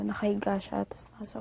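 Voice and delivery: whisper. Real, not acted. Recalling scene to friend.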